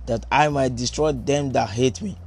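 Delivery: solemn, measured Bible reading; scripture reading. Acted. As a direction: read the verses with quiet reverence. Reads scripture passage aloud.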